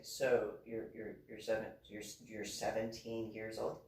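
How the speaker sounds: low, soft, stammering disbelief; imitated stunned commander. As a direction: imitate an officer quietly trying to take it in. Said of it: "he was just like".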